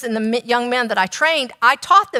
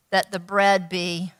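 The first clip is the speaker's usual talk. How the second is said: normal talking voice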